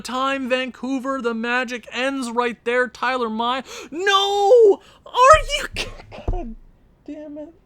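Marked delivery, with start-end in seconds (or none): 3.9-7.5 much higher, louder cry of disbelief; anguished shout. Real, not acted. Gamer screaming as his team concedes late. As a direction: yell it out in horror.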